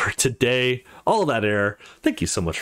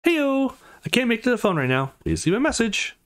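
bubbly recorded greeting, much higher; voicemail greeting; 11 semitones higher than usual. Acted. Jack calls Jessica and reaches her chirpy voicemail, much higher. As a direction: chirp it like a cheerful answering message.

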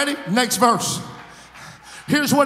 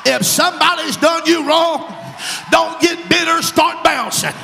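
higher, louder, climactic pulpit shout; preaching at full force; 4 semitones higher, 3 dB louder than usual. Acted. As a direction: whip the church up to the close.

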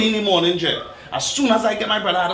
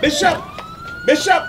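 urgent rising calls of his name; calling out. Acted. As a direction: call him, getting more urgent. Calling friend to the car.

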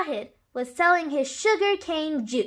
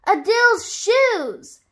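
high, slow exclamation, stretched out; shouted dismay; 7 semitones higher, 4 dB louder, slower than usual. A narrator's reading. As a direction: shout it slowly in exasperation. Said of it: "shouted everyone".

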